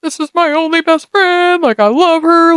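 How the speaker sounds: high-pitched mock wail over a friend; retold sobbing. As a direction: wail it high, imitating your own tearful self. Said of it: "I was just sobbing my eyes out".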